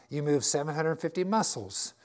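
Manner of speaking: normal talking voice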